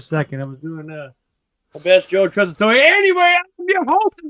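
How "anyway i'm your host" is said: much higher announcer call; ring announcer. Acted.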